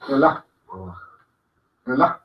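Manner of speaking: "relaj" ending on an aspirated j; relajo without o. Shown as a demonstration. Teacher explaining final A, "relaj" with breathed ending. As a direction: cut "relaj" short on a throat breath. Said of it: "o relajo sin la O".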